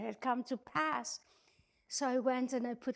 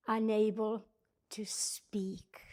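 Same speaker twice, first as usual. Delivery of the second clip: normal talking voice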